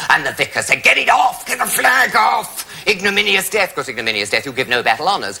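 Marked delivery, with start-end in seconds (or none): 0.8-3.7 vicar's shout. Retold or imitated. Vicar shouting about the flag.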